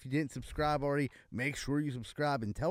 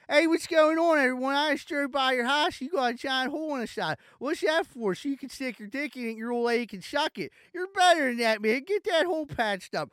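much higher, cartoonish salesman voice; squeaky mock pitchman; 12 semitones higher, 8 dB louder than usual. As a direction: go squeaky and silly for the ad. Fourth mock ad, very high voice.

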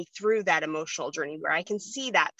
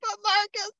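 pleading whimper; 13 semitones higher than usual. Retold or imitated. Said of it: "And I'm like".

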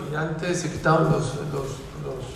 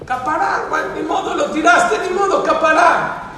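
agitated; 12 semitones higher, 6 dB louder than usual. Retold or imitated. Wife resigned after losing diamond.

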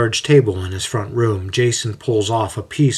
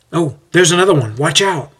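quick, higher heads-up; breezy, raised "watch out"; light-hearted warning; 7 semitones higher, 4 dB louder than usual. A narrator's reading. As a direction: call out a sudden hazard. Benjamin points out another puddle.

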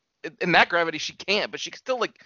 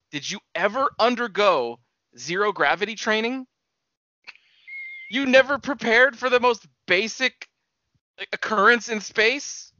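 worked-up rant; 7 semitones higher than usual. Real, not acted. Arguing with his co-host about zero gravity, worked up. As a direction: get heated over the ignorance. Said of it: "I gotta say why I got red hot".

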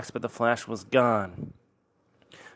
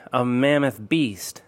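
plain reading voice

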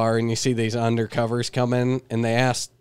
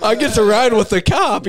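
much higher, louder, laughing mock quote; 11 semitones higher, 7 dB louder than usual. Mocking the guys' cop stories while laughing. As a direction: mimic them gleefully through a laugh.

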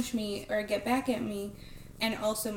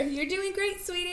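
high, softened mock praise; encouraging teacher; 7 semitones higher, 4 dB quieter than usual. Teacher reassuring the pupil on stage, high mock-sweet encouragement. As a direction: coo support at a struggling pupil.

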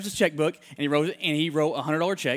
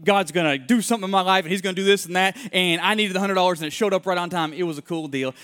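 ordinary talk

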